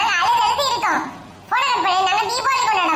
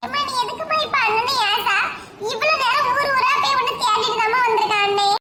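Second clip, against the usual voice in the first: high-pitched anxious sibling voice; scared young sibling; 5 semitones higher than usual. Acted. Siblings waiting for mother's call, nervous and high. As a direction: fret nervously over the phone, pitched up.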